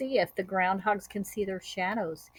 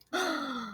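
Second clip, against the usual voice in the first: soft gasp; 5 semitones higher, 7 dB quieter than usual. Acted. Reader's surprised gasp.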